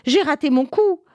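dismayed witch. Acted. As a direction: realize the failure.

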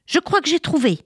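put-on high, bright cry of a girl with an idea; excited cry. Little girl announcing her idea to the children, excited cry. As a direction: shout it out like a sudden discovery. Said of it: "s'écria".

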